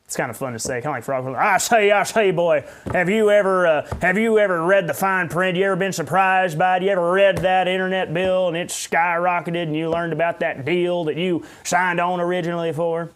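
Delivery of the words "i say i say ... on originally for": put-on Southern accent. Pastor imitating a folksy preacher in a hammy Southern accent. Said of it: "Southern accent like that".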